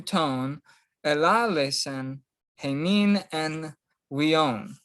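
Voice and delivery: slow, phrase-by-phrase reading of the Greek from "Palumeros"; careful Greek pronunciation. Shown as a demonstration. Teacher models the long verse for students, slow careful Greek pronunciation. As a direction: sound out "Palumeros kai palutrapos" slowly, syllable by syllable. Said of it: "here's the correct pronunciation".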